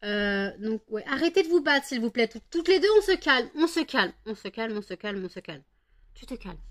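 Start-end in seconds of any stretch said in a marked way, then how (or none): 1.1-4.1 higher, loud commands to stop; breaking up a dogfight. Real, not acted. Owner yelling at two fighting dogs, higher and loud. 4.2-6.6 dropping to soft, soothing repeats; softer calming. Real, not acted. Owner softly calming the dogs once they stop, quieter.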